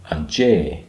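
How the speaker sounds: "anje" with a long, weighted final "jay"; stress on second syllable. Shown as a demonstration. Teacher showing the grave accent, "anje" stressed at the end. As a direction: rush the "an", draw out "je" in "anje". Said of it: "you emphasize that syllable".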